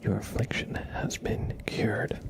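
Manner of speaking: normal talking voice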